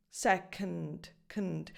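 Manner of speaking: "second, knd" with only a bridge from k to n; low, soft "second, knd", vowel nearly gone; bridged consonants. Shown as a demonstration. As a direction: go straight from k to n in "second", then "knd" alone. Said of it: "like, a bridge".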